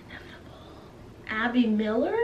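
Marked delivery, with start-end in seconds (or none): 0.1-0.6 a breathy unvoiced whisper. Shown as a demonstration. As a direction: let the word die into a whisper. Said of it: "repeated fading to a whisper".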